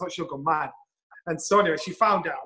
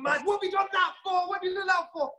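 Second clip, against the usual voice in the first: high, frantic mock wife scolding; wife scolding him in the lift, panicked; panicky wife; 14 semitones higher than usual. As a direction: shriek like a worried wife. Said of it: "she's going".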